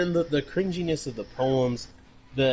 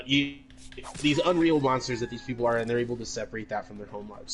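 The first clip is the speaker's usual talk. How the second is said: ordinary delivery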